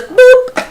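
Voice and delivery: a tiny high boop; mock boop. Guest mocking the killer's weak attacks, with a high silly boop. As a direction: squeak it out like a cartoon pop. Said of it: "And he just goes".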